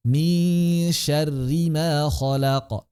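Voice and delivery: slow chanted tilawah; Quran recitation. Shown as a demonstration. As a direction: recite slowly with melody. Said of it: "recitation demo". (tilawah pelan)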